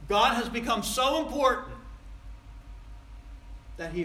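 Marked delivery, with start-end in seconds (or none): 0.1-1.8 louder, higher, stress on "so important"; raised preaching voice, pressing the point; emphatic proclamation. Real, not acted. Loud repeat of the statement.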